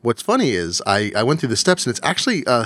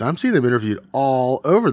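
normal talking voice

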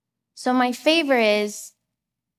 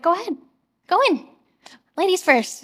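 higher, lightly voiced polite insistence; cheerful Adam; 7 semitones higher than usual. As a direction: usher her in with a grin. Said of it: "he's like".

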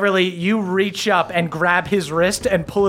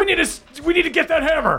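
higher, pressing dwarf hurrying her on; urgent dwarf voice; 10 semitones higher, much faster than usual. Acted. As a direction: push her to move, now. Dwarf urging Beverly to move.

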